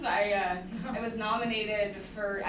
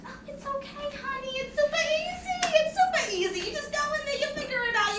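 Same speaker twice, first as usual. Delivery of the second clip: much higher, sing-song reassurance; high, chirpy honey voice; breezy recruiter; 4 dB louder than usual. Retold or imitated. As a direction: wave off his worries, airily. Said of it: "She said".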